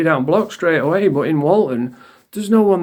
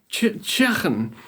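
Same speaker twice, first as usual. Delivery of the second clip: slow, softer, stuttered Scouse echo; mocking Scouse accent; 3 semitones higher, 5 dB quieter, slower than usual. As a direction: mimic his Scouse "chicken" in disbelief. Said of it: "If you say it like that".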